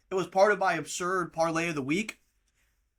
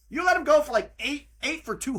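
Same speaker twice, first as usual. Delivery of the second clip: heated rant; 8 semitones higher, 3 dB louder than usual. Real, not acted. Ranting at Notre Dame.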